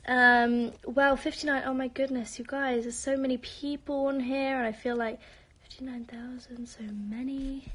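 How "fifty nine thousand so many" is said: whispered in disbelief; awed whisper at the viewer count. Real, not acted. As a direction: hush it out in awe.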